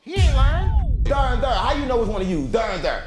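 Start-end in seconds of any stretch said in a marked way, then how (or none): 0.0-1.5 raised, louder mock exasperation; loud insistence. To friends mocking the there and there mixup, loud and exasperated. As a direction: push it out, exasperated.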